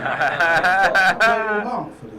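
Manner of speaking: ordinary talk, nothing marked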